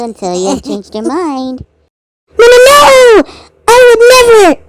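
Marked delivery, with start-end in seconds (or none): 2.4-4.6 high, loud panicked no; frantic shouted denial; shouted refusal. Acted. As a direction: yell the refusal in alarm. Maroon refusing the hug.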